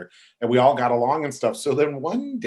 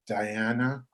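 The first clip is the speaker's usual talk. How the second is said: soft, grave admission acted out; pause, then confess quietly; sheepish confession; 2 semitones lower, 7 dB quieter, slower than usual.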